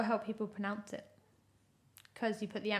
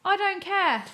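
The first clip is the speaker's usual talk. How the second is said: playful; 9 semitones higher, 7 dB louder, slower than usual. Real, not acted. Playful dismissal of partner.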